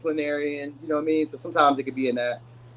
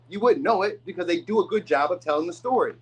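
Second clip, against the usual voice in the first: normal talking voice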